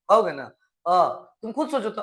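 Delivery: normal talking voice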